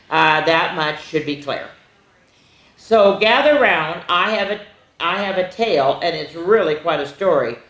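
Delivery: animated picture-book reading; storybook narration. Acted. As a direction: tell it to a class of kids. Reads the turkey's story.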